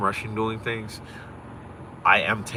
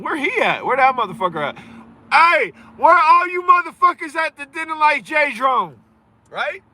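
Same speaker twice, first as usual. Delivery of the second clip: taunting shout; 6 dB louder than usual. Real, not acted. Streamer taunting viewers who mocked his club, loud and high. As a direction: crow loudly at the haters.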